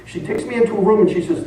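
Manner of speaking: ordinary talk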